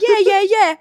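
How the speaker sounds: imitating the rugby guy, high and loud; quick high, loud repeated assurance, retold; mock brushing-off. Rugby guy waves off the tour leader's question, mock brushing-off. Traveler insisting he is okay.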